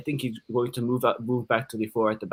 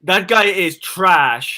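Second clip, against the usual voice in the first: emphatic slow declaration; 5 semitones higher, 7 dB louder, much slower than usual. Real, not acted. Emphatic hot take.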